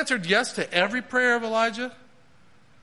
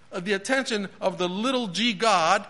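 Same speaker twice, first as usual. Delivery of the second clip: usual speaking voice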